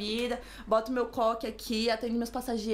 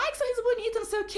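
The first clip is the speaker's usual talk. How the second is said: high-pitched, effusive mock praise; gushing compliment; 10 semitones higher, faster than usual. Interviewer complimenting the candidate's pretty smile, gushing.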